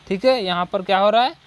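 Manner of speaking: normal talking voice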